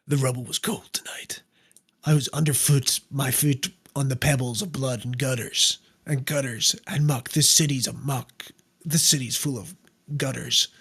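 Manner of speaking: hushed gritty noir narration; low whispered hard-boiled monologue; noir detective. Pretend.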